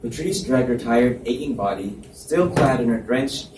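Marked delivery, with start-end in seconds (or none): none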